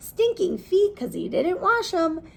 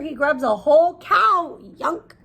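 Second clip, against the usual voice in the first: usual speaking voice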